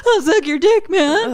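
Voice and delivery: high, loud blubbering plea; whiny mock crying. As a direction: wail it like a coward begging. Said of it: "you're like".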